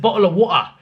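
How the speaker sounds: "Bottle of water" with London glottal stops for T's; Londoner pronunciation. Retold or imitated. Quoting the seller who copies Londoners, dropped T's in "Bottle of water". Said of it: "pronounce it like that".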